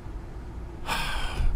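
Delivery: long, soft exhale; weary sigh. Real, not acted. Streamer after an abusive donation, weary sigh. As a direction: breathe out in exasperation.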